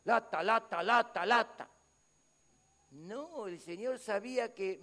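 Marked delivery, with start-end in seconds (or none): 0.0-1.7 a hint of a bored mocking "lata, lata"; imitating the Lord calling it the same old nagging. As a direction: drone it like a tired complaint. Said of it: "el Señor decía otra vez la mi".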